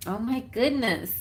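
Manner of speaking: ordinary delivery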